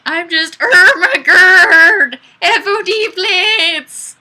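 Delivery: slow, loud, high distorted wail; hammed-up Ermagerd. Streamer reading a viewer's comment as a goofy Ermagerd wail.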